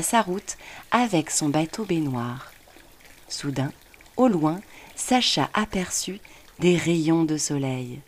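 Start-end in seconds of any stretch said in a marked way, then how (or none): none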